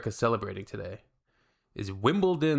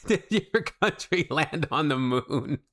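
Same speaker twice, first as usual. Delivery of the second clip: slow, breaking up with laughter; real laugh running through the question; laughing while reading; 4 semitones higher, slower than usual. Real, not acted. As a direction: read it through helpless laughs.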